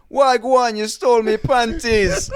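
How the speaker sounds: loud, high, hammed-up Jamaican patois; Jamaican accent. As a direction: belt it out in a mock Jamaican accent. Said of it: "um, she's Jamaican".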